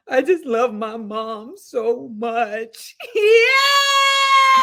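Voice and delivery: high wailing, slow hammed-up sob; mock crying. Mocking her crying date in the dark car, wailing. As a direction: wail it like a big baby. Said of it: "Are you crying?".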